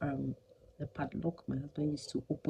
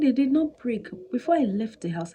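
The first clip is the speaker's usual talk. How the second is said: higher, louder insistence; angry retort; 8 semitones higher, 7 dB louder than usual. Retold or imitated. Wife retelling her angry reply, higher and louder. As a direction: push back hard at the husband. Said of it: "I said".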